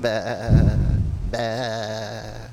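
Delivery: long, held bleat; imitated mummy sound. Host demonstrating the sound the mummy made, long bleat. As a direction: draw out one dull vowel. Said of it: "That's the sound that they made".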